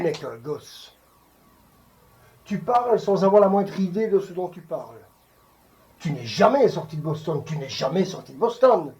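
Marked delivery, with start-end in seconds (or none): 2.4-8.9 forceful, louder scolding; reproche véhément. Acted. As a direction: hammer the accusation home, louder. Acted scolding of a younger man. (reproche lancé avec force)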